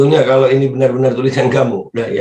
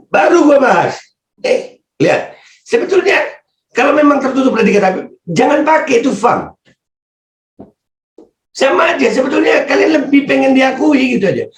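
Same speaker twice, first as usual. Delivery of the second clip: much higher, pushing hard through the rant; worked-up lecture at the fam; heated rant; 12 semitones higher than usual. Real, not acted. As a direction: tinggi dan berapi-api. Ranting higher and louder at opponents.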